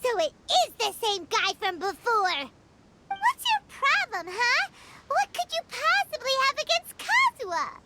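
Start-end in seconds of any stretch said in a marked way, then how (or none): 3.1-7.8 high, sharp demand; indignant challenge. Acted. Defending Kazuha against the attacker, high indignant challenge. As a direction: get in his face for a friend.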